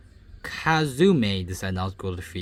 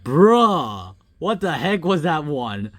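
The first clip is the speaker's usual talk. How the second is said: loud, higher-pitched disbelief; shocked reaction; 6 semitones higher, 7 dB louder, slower than usual. Real, not acted. Gamer reacting to a baffling game event, shocked. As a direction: burst out in baffled surprise.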